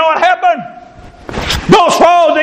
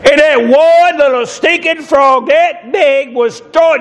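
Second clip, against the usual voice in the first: ordinary talk, nothing marked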